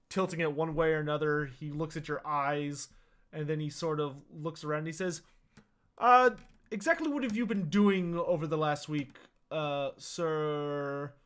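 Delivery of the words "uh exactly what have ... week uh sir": a fussy physician sizing up a sick patient; Doctor Rupert's voice. Acted. Doctor greets ailing Verstadt, slow and measured.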